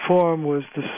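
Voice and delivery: ordinary talk, nothing marked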